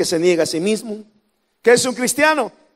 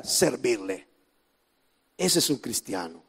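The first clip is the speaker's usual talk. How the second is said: much lower and softer; hushed emphasis; 10 semitones lower, 11 dB quieter than usual. Real, not acted. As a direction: drop to a near hush for the point. Sermon point delivered hushed.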